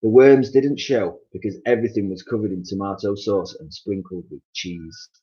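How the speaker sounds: ordinary delivery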